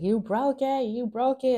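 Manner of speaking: high-pitched imitation of an upset child; child going off. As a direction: play a child throwing a fit. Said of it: "going off".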